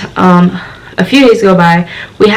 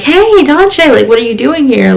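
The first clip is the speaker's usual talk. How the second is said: high, louder mock-friendly hello; friendly greeting; 6 semitones higher, 5 dB louder than usual. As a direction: imitate staff happily greeting a familiar student. Friendly staff greet her in retold scene.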